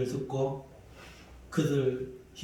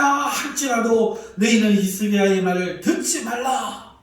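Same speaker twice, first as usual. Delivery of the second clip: higher and louder, with the stress on 듣지; loud warning; 5 semitones higher, 8 dB louder than usual. Acted. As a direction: shout 듣지 hard at the end of the warning. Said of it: "이렇게 큰 소리로 말합니다".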